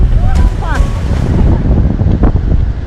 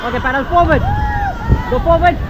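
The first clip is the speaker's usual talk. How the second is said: shouting over rushing water; 13 semitones higher, 8 dB quieter, faster than usual. Real, not acted. Raft guide calling paddle commands.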